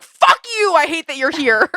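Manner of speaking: imagined worker snapping at the repair guy, mock furious; mock angry outburst. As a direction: spit it at the repairman, hammed-up fury. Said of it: "I would have such a hatred".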